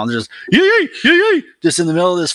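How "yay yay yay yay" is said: high, loud whooping Bigfoot call; Sasquatch call. Retold or imitated. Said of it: "we gotta do the Sasquatch call".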